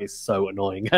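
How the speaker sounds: low, quiet, weary Murray moan; a mild, deadpan take on Murray's fed-up tone; fed-up Murray, complaining quietly at a press conference; imitating Murray's tone. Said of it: "And in his tone".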